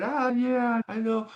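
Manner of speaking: high-pitched amused mimicry; imitating laughing reply. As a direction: play the teammate laughing it off. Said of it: "he's laughing".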